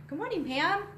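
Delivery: cheery greeting. Real, not acted. Greeting a viewer by name.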